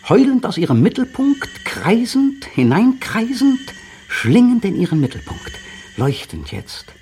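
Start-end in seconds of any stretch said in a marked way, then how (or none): 0.1-5.5 rising ecstasy. Acted. Caught in the howling siren spiral, voice soaring in rapture. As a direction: let the voice climb as if pulled into a whirl.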